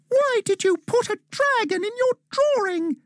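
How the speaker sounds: high, bright little elf urging Dan on; squeaky elf calling for help, urgent; a high elf voice begging a boy to hurry. A narrator's reading.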